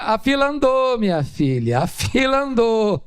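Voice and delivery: high, sing-song teasing dismissal; mock carefree husband; cheeky brush-off. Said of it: "Eu diria".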